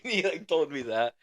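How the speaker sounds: ordinary talk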